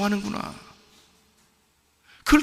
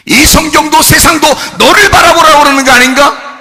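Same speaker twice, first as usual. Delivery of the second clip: higher and louder, shouting preacher; preaching at full force; 9 semitones higher, 10 dB louder than usual. Acted. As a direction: drive the climax home to the congregation. (절정에서 외치는 설교)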